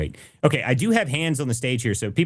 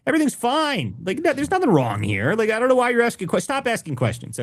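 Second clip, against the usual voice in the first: sarcastic mock apologist; 8 semitones higher than usual. Sarcastic fraud-apologist act.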